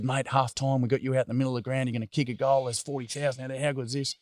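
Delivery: a retold, eager sales pitch to a kid; excited pitch. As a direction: pump the kid up. Narrator hyping up Bass.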